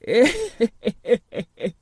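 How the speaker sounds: put-on old man's wheeze of amusement; amused old gardener's chuckle; chuckling. A narrator's reading. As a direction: chuckle in character. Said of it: "he ended by chuckling".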